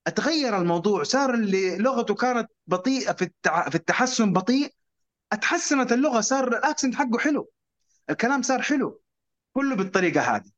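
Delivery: ordinary delivery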